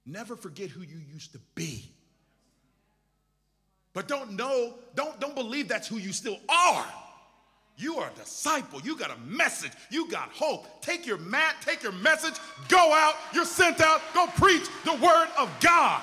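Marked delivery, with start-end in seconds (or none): 3.9-12.3 climbing from firm to a full shouted cry; building to a preached shout. Acted. Preacher sending disciples out, building to a shout. 12.7-16.0 high, loud preacher's shout; shouted sending-out. Acted. Preacher commanding the church to go out, shouted.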